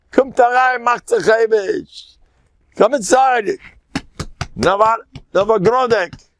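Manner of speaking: high, intense sermon chant, drawn out; fervent preaching. Acted. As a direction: preach it with full fire to the crowd. Preaching singsong again.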